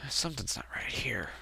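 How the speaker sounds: a hushed, wary aside; suspicious whisper. Retold or imitated. To himself about his dad, whispering. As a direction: whisper it under your breath.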